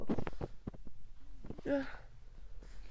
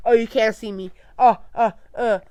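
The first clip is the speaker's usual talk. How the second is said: loud, sarcastic sing-song whining over "you, you" and "my, my"; mock Edward; 12 dB louder than usual. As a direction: jeer at his "my way" attitude. Reader mocks Edward's selfishness.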